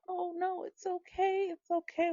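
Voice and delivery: calm the little one down while hurting; gentle mock-calm it's okay; reassuring. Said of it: "trying to reassure them".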